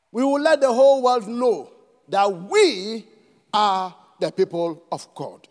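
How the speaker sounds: normal talking voice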